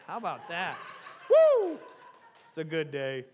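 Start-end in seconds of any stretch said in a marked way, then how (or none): 1.3-1.8 high, loud "woo"; loud whoop. Real, not acted. Pastor celebrates his impression before congregation, loud whoop. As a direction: let out a big high whoop.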